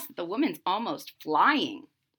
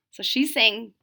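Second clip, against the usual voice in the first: ordinary delivery, nothing marked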